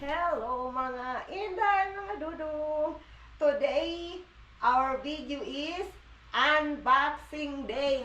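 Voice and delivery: slow, drawn-out greeting; vlog intro. Real, not acted. Vlogger opening the unboxing video with a slow drawn-out greeting. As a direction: greet the viewers slowly and stretched.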